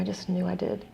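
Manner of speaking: normal talking voice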